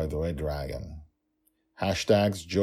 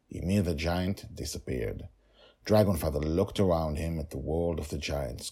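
usual speaking voice

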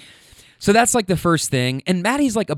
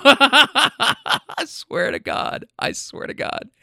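helpless laughter, high then fading to breath; laughing hard, voice giving out on the repeat; real laughing fit; 9 semitones higher, much slower than usual. Real, not acted. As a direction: crack up while swearing it's true. Cracks up at the hero line.

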